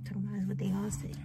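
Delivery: whispered aside. Real, not acted. Muttered aside.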